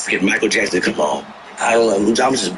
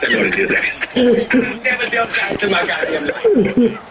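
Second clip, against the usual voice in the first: laughing; 12 semitones higher than usual. Real, not acted. Bluffing about dealing dice.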